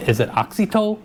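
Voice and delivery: Oxytow as AHK-see-toe, lifted high at the end; "Oxytow" with a short open first O, questioning; questioned pronunciation. Retold or imitated. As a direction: ask it as AHK-see-toe, pitch jumping up. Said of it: "how do you pronounce this?".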